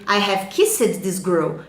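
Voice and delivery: slow, careful English; slow model sentence. Shown as a demonstration. Teacher spells it out slowly for students. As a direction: spell each word out slowly. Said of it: "e você diz pra ele".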